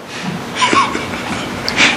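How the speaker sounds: high breathless burst of laughter; unvoiced giggle breaking out; laughing. Real, not acted. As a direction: let a bright airy laugh burst out. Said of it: "He starts laughing".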